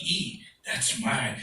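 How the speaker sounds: usual speaking voice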